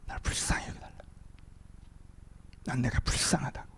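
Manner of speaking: very soft, nearly whispered begging; hushed desperate plea. Acted. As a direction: plead with the voice almost gone. Acted beggar's plea, voice collapses. (속삭이듯 애타는 간청)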